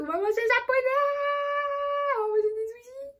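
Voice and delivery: high squealy excitement, fast; excited. Real, not acted. Vlogger thrilled about going for sushi, high excited voice. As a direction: bounce with joy about sushi.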